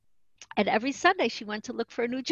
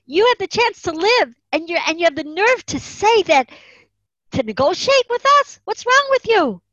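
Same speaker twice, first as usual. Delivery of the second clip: much higher and louder, enraged officer; furious yell; 10 semitones higher, 9 dB louder than usual. Retold or imitated. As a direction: bellow it at the girl in outrage. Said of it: "He yelled at her".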